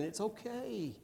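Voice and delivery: put-on soft, hushed comfort to the kids; quiet, tender It's okay; tender reassurance. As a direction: murmur it gently to your children. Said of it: "when I told my children".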